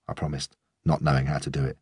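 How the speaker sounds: ordinary delivery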